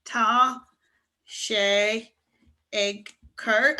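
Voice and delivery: slow teacher's model with pauses; spaced out, one word at a time; word-by-word demonstration. Shown as a demonstration. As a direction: separate each word for learners to copy. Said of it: "if you wanna say it's snowing".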